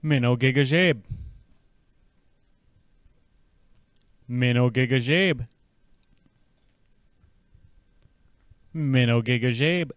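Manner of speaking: "Minnow gigajeb" with the main stress on "gigajeb"; "Minnow gigajeb", falling, stress on gigajeb; whole phrase. Shown as a demonstration. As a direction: model the greeting for the learner. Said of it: "Let's try the whole sentence now".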